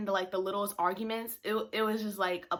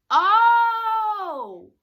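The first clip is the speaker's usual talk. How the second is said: loud, high, drawn-out "oh"; surprised oh; 11 semitones higher, 9 dB louder than usual. Retold or imitated. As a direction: let a big realization ring out. Surprised realization about the friend.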